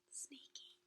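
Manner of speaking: gasp, then a hushed sneaky; whispered aside. Real, not acted. Storyteller whispers to the kids, hushed. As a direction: breathe it like a secret.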